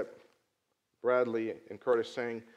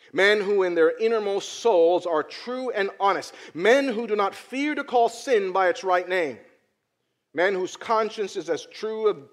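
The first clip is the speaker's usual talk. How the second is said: preaching; 4 semitones higher, 6 dB louder than usual. Acted. Preaches a quote.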